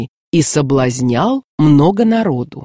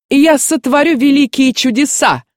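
high-pitched boastful proclamation, storyteller's version; narrated cry; 9 semitones higher than usual. A narrator's reading. Sorcerer promises miracles to the prince, crying out high. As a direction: cry it out high, without full shouting volume. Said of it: "закричал волхв".

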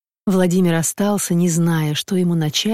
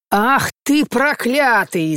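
shrieking curse; 5 semitones higher, much slower than usual. Acted. Wife shrieks at the husband who hit her, drawn-out furious cry. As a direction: drag out the curse as she shrieks in pain. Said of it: "закричала Марфа Петровна".

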